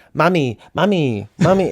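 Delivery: high, whiny hammed-up mommy call; hammed-up kid voice. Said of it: "hamming up".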